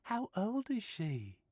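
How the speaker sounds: put-on breezy Australian girl voice; easygoing Australian woman reassuring Stephen; Australian accent. Sylvia cooing at dog.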